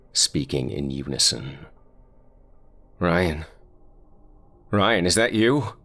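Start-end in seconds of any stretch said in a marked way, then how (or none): 3.0-5.7 choked out, fearful. Acted. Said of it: "Tommy choked out between stunted breaths".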